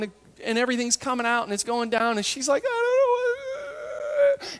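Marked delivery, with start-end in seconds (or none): 2.7-4.5 high, very slow, choked-up mock sob; imitating an ugly cry. Mary weeping at the empty tomb, a mock choked sob. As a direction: squeeze the words out through tears. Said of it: "awful ones where there's snot".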